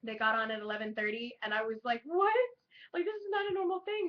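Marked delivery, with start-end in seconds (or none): 2.1-4.1 surprised. Retold or imitated. Retelling surprise at early set.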